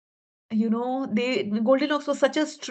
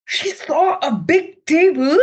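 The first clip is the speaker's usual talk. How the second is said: excited; 6 semitones higher, 7 dB louder than usual. A narrator's reading. Storyteller voices a sudden discovery.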